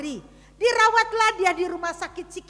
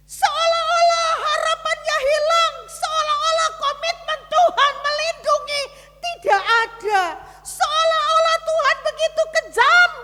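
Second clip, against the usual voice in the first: full-force preaching; 11 semitones higher, 6 dB louder than usual. Acted. Pastor preaching to the congregation, loud and high. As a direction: preach the despair out to the hall.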